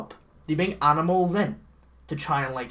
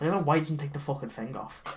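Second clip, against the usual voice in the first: ordinary talk, nothing marked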